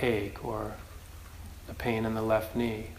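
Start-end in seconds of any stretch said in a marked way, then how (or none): none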